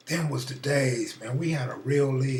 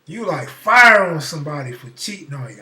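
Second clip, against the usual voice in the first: ordinary delivery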